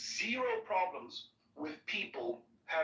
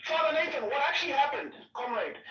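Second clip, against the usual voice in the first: louder, higher appeal; raised voice; 8 semitones higher, 4 dB louder than usual. Real, not acted. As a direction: call out to him loudly. Appeals loudly.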